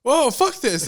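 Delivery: high, loud startled recoil; retold alarm. Man finding a person in the trash, retold alarm. Retold shock at garbage can discovery.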